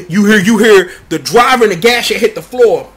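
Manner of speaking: plain reading voice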